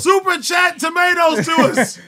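rallying shout. Real, not acted. Host demanding tomatoes from live chat, rallying shout. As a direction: holler it out to the crowd.

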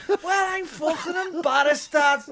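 Scouse son yelling back at his dad; bellowed Liverpool outburst; Scouse lad shouting at his dad; angry Scouse shout. Retold or imitated. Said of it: "impersonations of his angry Scouse bloke".

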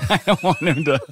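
high giggling through the words; laughing nervously. Real, not acted. Podcast host laughing nervously about his son overhearing. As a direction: laugh it out, half scared. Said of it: "'Cause I'm just scared".

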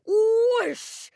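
high, loud drawn-out whoosh; shooting-star whoosh. A narrator's reading. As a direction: sweep it out like a rocketing star.

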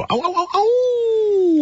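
high, slow yipping howl; coyote howl. Acted. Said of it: "Is that the coyote call?".

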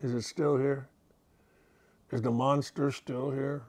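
put-on soft, small scared child's whisper; childlike fear. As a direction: peek out from under the covers. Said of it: "afraid of monsters".

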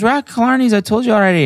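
very high, mock-annoyed friend voice; high-pitched, whiny insistence; Bridget insisting. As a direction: mimic an exasperated friend on the phone. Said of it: "she goes".

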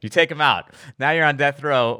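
normal talking voice

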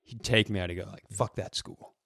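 ordinary talk, nothing marked

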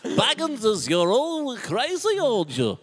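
high, loud, drawn-out character cry; hammed-up movie character impression; movie-quote voice. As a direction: shout it in a silly film voice. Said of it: "in the same voice".

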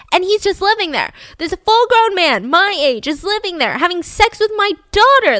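mildly hammed-up fatherly disgust; outraged father. Father thinking about the man living with his daughter, indignant.

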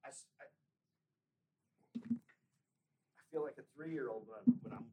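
strained voice after swallowing wrong; throat still raw from coughing; hoarse after choking. Real, not acted. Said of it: "I swallowed wrong".

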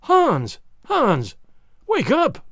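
loud, high pleading calls; crying out. Acted. Wife clings to husband and cries out loudly to rouse him. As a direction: shout his name to snap him out. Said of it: "she cried".

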